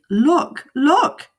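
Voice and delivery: high, loud panicked cry; frightened fish. A narrator's reading. Fish spotting mother coming home, loud panic. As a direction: shout it like a fish shaking with fear. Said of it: "our fish shook with fear".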